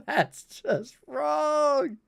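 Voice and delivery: slow, high words half swallowed by laughter; laughing it out. Real, not acted. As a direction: drag it out while still giggling. Laughing after rabbit farts joke.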